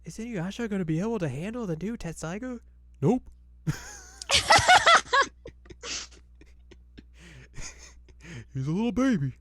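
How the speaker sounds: a lightly voiced teasing old smith; mock taunt. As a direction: taunt like a smug old man. Mocking voice, higher.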